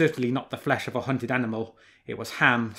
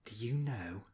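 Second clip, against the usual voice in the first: murmured; 10 dB quieter than usual. A narrator's reading. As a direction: mutter it under the breath. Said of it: "he murmured twice".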